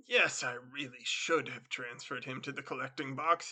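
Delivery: ordinary delivery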